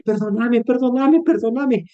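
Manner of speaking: put-on high, pleading little girl; pleading child. Niece to crying sister, high pleading little voice. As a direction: beg like a worried child.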